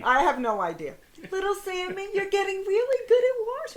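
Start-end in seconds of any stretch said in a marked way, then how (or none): 1.3-3.8 high, sugary wake-up coo; mock gentle parent; exaggerated gentle parent. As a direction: coax a child out of bed too sweetly. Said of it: "I love the next one".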